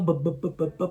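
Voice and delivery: stuck stammer on b, b, b; mock stammer, searching for a word. Shown as a demonstration. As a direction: stammer like you can't find the word.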